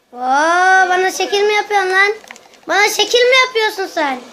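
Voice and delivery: playful loud provocation; mock challenge. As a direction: pick a fight jokingly. Challenging game character.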